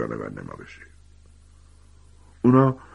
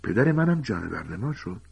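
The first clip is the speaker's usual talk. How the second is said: stunned Harry; 5 semitones higher than usual. A narrator's reading. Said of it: "هری که مات و مبهوت مانده بود گفت".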